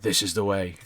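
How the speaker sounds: hint of a grave bounty hunter; Mandalorian line. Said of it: "he starts saying Mandalorian lines".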